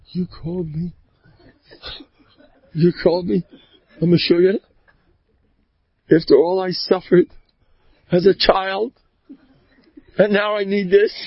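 mock wounded indignation. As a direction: act hurt, pausing between phrases. Acted wounded husband answering wife.

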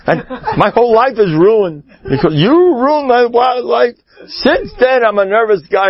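high, whining about a ruined life; a hammed-up high complaint about a ruined life; mock kvetcher. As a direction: whine and blame high like a self-pitying man. Voicing a self-pitying angry man.

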